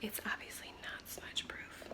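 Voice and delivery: whispered aside. Real, not acted. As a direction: breathe it as a private aside. Muttered aside.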